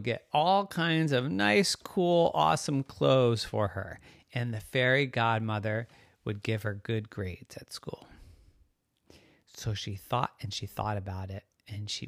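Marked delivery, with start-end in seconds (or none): none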